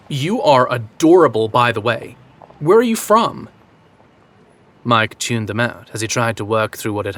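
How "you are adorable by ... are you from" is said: put-on Valley girl American accent; fake American accent. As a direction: Bostonian trying a California accent. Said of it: "decent approximation of an American accent".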